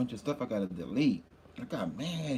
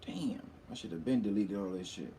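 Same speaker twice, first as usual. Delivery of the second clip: whispered; 3 semitones lower, 7 dB quieter than usual. Real, not acted. Muttering to himself while deleting.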